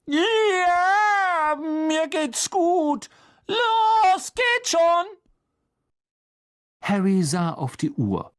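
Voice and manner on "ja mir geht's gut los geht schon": very high, loud, impatient squeal; shrill voice. A narrator's reading. Hermine behind the door hurries them off, shrill and loud. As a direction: shrilly wave off the fuss. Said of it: "Eine schrille Stimme".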